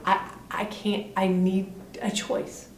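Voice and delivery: soft, halting, voice dropping away; slow, quiet and faltering, standing up for himself; hesitant plea. Acted. Man telling his family he needs a choice, hesitant plea. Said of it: "first time he has said to anybody".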